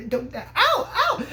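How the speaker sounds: high, drawn-out cries of pain; comic high squawks of protest; mock pained yelp. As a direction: yelp as if being hurt, hammed up. Acting out being hurt, crying out.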